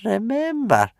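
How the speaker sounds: put-on high, wheezy old man; grandfather's parting words to Hiccup; wheezing old grandfather. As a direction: wheeze it out lovingly. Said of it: "wheezed old Wrinkly".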